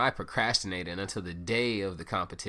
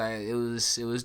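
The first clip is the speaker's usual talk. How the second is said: ordinary talk, nothing marked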